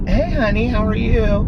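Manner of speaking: high, sing-song imitation of a friend; sweet phone greeting. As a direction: put on her sugary hello.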